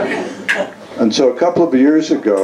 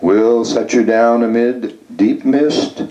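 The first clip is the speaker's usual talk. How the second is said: higher, lilting list with rising ends; poem reading; 4 semitones higher than usual. Acted. Poet reciting a travel service sonnet to an audience, lilting. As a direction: recite it like a singsong travel pitch.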